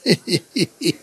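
short staccato giggle; laugh. Real, not acted. Preacher after glorifying God, staccato laugh. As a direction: giggle in quick separate bursts.

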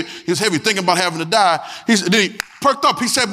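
ordinary talk, nothing marked

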